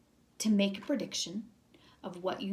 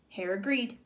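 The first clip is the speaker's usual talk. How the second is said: plain reading voice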